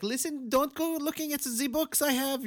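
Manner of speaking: high mock German accent; bad German accent. Retelling the grandpa warning the girl, in a mock German accent. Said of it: "that bunk-ass accent".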